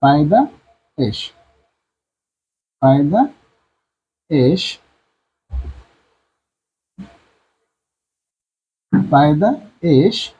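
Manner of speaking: পায়দাইশ said slowly, writing on the board; slow dictation. Shown as a demonstration. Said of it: "teacher dictating while writing".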